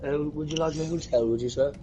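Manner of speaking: put-on British accent. Mocking a British hotel receptionist on the phone, posh British accent. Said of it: "in a British accent".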